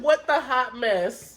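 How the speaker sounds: slow, drawn-out disbelief; stunned disbelief. Retold or imitated. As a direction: let each word hang, bewildered. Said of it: "I was like".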